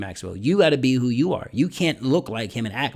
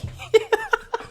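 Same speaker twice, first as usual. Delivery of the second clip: high-pitched burst of laughter; real laugh. Real, not acted. Speaker talking about speaking finds it funny, laughing. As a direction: laugh at the irony of it. Amused by own point.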